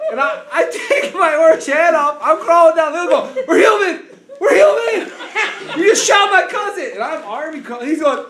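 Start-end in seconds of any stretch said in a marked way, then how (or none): 3.5-6.9 loud, high pleading shout; frantic mock holler; retold desperate yell. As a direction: yell to stop distant shooters. Calling out to hunters while crawling.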